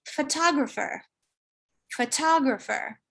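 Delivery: photographer with stress on the second syllable, other syllables reduced to schwa; standard pronunciation. Shown as a demonstration. Teacher demonstrating the native standard: photographer stressed on the second syllable. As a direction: say photographer as fuh-TOG-ruh-fer, stress on the tog. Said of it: "It's actually pronounced".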